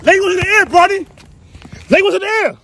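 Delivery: high, loud coaching shout; yelling from the sidelines. Real, not acted. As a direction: bark it across the court. Dad yelling the correction.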